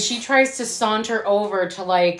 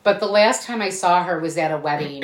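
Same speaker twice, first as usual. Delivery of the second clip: ordinary talk, nothing marked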